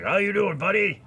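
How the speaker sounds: high, loud character imitation; Beetlejuice. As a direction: greet the cat in a put-on ghoul voice. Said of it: "talking to him like that".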